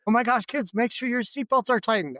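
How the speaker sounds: high-voiced impression of a fussing wife; imitating wife. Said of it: "And she's like".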